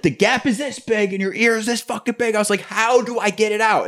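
high, heated retold protest; exasperated mock rant. Retelling pulling the earring out, exasperated to girlfriend's face. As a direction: jab the words like a know-it-all. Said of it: "I did this in her face like an asshole".

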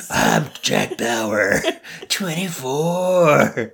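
slow dramatic Jack Bauer impression; Kiefer Sutherland voice. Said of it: "my general Kiefer Sutherland".

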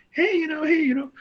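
high, soft sweet-talking voice, like cooing to a grandma. Retold or imitated. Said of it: "like you're talking to a grandma voice".

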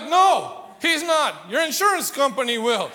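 loud, high, laughing comeback; laughing retort. Retold or imitated. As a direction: shout it back through laughter, half joking. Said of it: "And I'm like".

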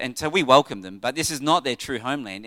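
ordinary talk, nothing marked

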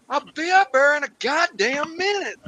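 high, irritated call up the stairs; calling upstairs. Acted. Father snapping up the stairs at Mama. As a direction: shout it up impatiently.

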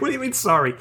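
high, disbelieving question; incredulous. Retold or imitated. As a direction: ask it in total disbelief. Incredulous retort.

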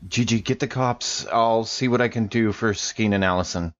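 ordinary delivery

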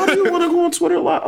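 high, fast, laughing disbelief; exasperated. Retold or imitated. Son quizzing his mom about Twitter live, exasperated. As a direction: fire off the question in disbelief.

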